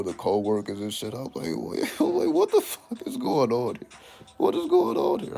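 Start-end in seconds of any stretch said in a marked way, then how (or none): 1.6-5.3 very high, louder, laughing incredulity; stunned coworker discovers everyone smokes; high laughing disbelief. Retold or imitated. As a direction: squeal it out half laughing, stunned. Shocked discovery at work.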